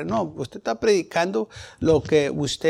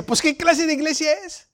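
puzzled friend; 11 semitones higher than usual. Retold or imitated. Amigo perplejo, citado.

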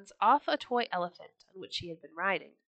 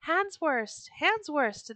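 high, mildly raised storybook cheer; crowd's shout; 7 semitones higher, 3 dB louder, slower than usual. A narrator's reading. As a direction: call the name out like a delighted crowd. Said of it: "they shouted".

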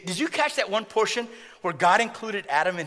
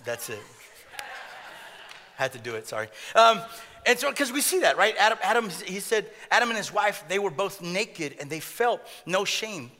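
normal talking voice

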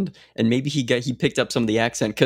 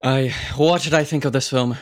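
put-on Irish lilt; Irish accent. Podcast guest dodging the host's question, mock Irish accent. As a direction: answer in a playful Irish accent. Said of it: "any opportunity to do an Irish accent".

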